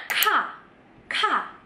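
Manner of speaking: "ka" as breathy "kha"; strongly aspirated K. Shown as a demonstration. Teacher demonstrating the next letter, strong puff. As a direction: blast "ka" out with a big H. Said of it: "ada haknya banget cara bacanya".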